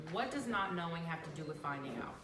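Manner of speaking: ordinary talk, nothing marked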